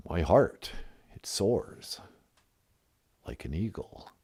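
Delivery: a mock-solemn caller named Tonto; low solemn Tonto voice.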